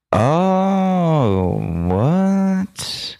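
high, drawn-out shocked "Oh, what?"; rising disbelief. Real, not acted. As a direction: recoil at the picture. Disgusted surprise at a picture.

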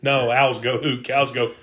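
ordinary talk, nothing marked

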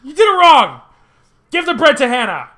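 high, loud imitation of a strict teacher; mock scolding yell. Teacher correcting Colton at the table, mock scolding yell. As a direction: order it in an exaggerated yell.

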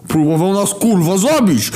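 high, loud outraged yell. A narrator's reading. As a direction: shout it in disbelief. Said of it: "wrzasnął Głód".

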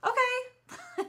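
very high-pitched offhand okay; mock casual acceptance. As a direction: toss back a light, unbothered okay. Coworkers' unbothered reply, imitated.

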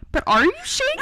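high, far-off caring question; mock concerned friend. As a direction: tease the friend's worried asking. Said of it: "The entire time I kept hearing".

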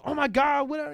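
high-pitched mock gushing; gushing greeting. Girlfriend greeting an old friend at dinner, mock gushing. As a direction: mimic an over-excited hello. Acts out woman's excited greeting.

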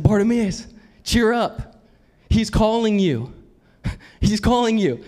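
high-pitched eager encouragement; changed, cheering tone. Acted. Crowd urging the blind man to Jesus, cheering. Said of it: "crowd completely changed their tone".

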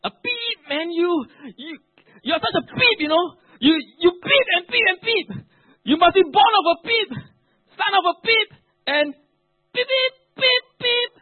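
high, loud tirade of censored abuse; loud, high-pitched mock abuse; hurling insults. Shown as a demonstration. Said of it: "This is how it sounds like".

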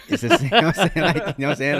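giggling while talking; chuckling through the words; laughing. Real, not acted.

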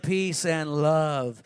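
low, long drawn-out hippie voice; laid-back hippie. Acted. Preacher imitates a cooler New Testament Jesus, slow low hippie voice. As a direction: stretch it out like a mellow hippie. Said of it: "Little hippie in him".

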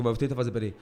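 ordinary delivery

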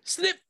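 squeaky snip; high snip sound. Pretend. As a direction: snap it high like a claw. Crab snipping sound.

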